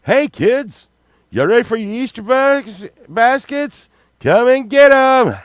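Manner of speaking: high, loud spooky grandpa voice; creepy mask voice. Pretend. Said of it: "my grandkids would just roll their eyes".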